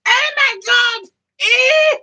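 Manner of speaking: high, loud, drawn-out squeal of delight; mock ecstatic cry. Gloating over cheap gas prices, hammed-up high ecstatic shriek. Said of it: "I'd be jerking myself up going".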